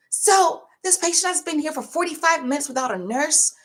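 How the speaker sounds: high, pushy accusing voice; hammed-up snide nurse; mocking coworker. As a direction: imitate the rude nurse accusing. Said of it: "And then she goes, in front of the patient".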